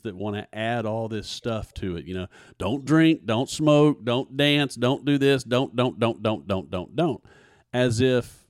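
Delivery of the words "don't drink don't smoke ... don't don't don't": loud, higher, rattling off prohibitions; mock preachy rules. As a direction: hammer out each don't like a scolding preacher. Mocking churches' list of rules.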